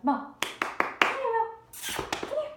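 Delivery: high, loud call across the room; calling the dog. Real, not acted. Trainer calling her dog Mom over, high and loud.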